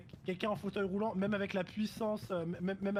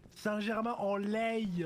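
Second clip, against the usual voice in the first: Saint-Germain-en-Laye drawn out slowly, Parisian style, Laye as "lèye"; Parisian French accent; 3 semitones higher, 4 dB louder, much slower than usual. Shown as a demonstration. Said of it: "avec l'accent parisien".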